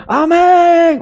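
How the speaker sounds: high, loud cried-out amen; group shout. Retold or imitated. Teens answering the leader's call, loud cried amen. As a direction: belt it back like a crowd. Youth shouting back at retreat.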